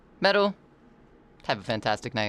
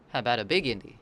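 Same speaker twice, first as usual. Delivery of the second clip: ordinary talk, nothing marked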